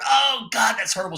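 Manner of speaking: high, loud hammed-up wail; mock pain. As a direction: play a joke patient yelping when touched. Joke patient howls in pain.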